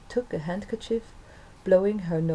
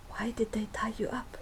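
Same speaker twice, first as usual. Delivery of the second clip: soft unvoiced whisper, a bit higher; girl whispering a question to the tied-up woman; 4 semitones higher, 7 dB quieter than usual. A narrator's reading. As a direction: whisper it so no one hears. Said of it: "she whispered".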